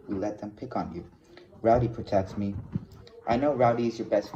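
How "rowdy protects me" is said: usual speaking voice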